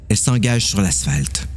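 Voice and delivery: ordinary talk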